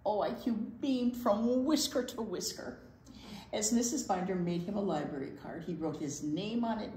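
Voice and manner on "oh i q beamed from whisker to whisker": plain reading voice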